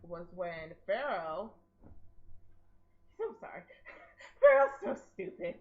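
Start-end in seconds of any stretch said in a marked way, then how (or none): 3.2-5.5 laughing. Real, not acted. Laughing about Pharaoh.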